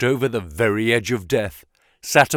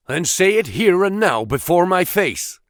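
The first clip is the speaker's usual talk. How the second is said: raised, higher challenge, pushed out; defiant cry; 7 semitones higher, 3 dB louder than usual. A narrator's reading. McMurdo challenging Baldwin to speak openly, defiant cry. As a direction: call him out to his face. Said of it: "cried McMurdo".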